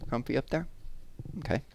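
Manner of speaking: high, soft, gentle voice to a toy; tender aside. Real, not acted. Reader settles a toy bear onto her lap, softly cooing. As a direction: coo softly as if settling a little guest.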